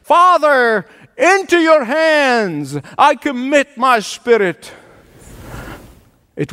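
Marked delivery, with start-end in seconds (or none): 0.0-4.7 slow, higher-pitched cry in a preacher's retelling; drawn-out, raised-pitch quoted cry; retold loud cry. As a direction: call it out slowly, imitating a dying cry without a full shout. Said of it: "cried out with a loud voice".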